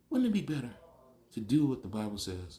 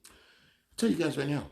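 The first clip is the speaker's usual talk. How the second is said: normal talking voice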